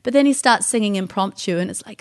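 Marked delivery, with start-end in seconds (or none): none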